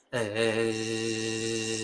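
long low hum closing into a buzz; throat sound into z. Shown as a demonstration. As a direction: hold "eh" and let it turn to zzz. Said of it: "los dientes van quedando cerca". (sonido de garganta que acaba en zumbido)

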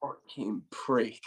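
slow, dragged-out insult; drawn-out curse. Retold or imitated. A player cursing the coach, slowly dragged out. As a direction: let the insult drag out slowly.

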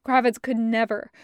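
ordinary delivery

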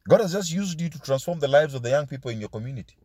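usual speaking voice

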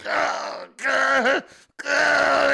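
short hammed-up groans of effort; mock straining groans. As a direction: groan step by step under a weight. McCain straining under the load.

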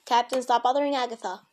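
loud firm scold. Real, not acted. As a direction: tell the cat off loudly. Scolding other cat.

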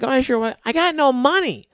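high-pitched mock broke friend; mocking imitation. Mocking the movers who have no money, high mimicry.

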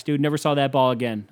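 normal talking voice

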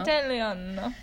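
rolled, sung-out Italian Italiano; Italian accent. Shown as a demonstration. Teaching the audience Italian, mock Italian accent. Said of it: "this time we want to teach you Italian".

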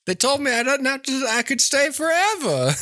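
put-on high, whooping old man; giddy high-pitched codger; gleeful old man avatar. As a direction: crow with joy, hammed-up. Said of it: "there's an old man avatar".

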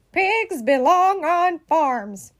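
bossy, insistent declaration; pompous pronouncement. A narrator's reading. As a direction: lay down the law word by word. Eugenia pompously gives her opinion.

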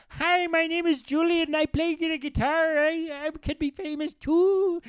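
high, dopey son introduction; hammed-up son bragging; mock son voice. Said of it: "here's my son".

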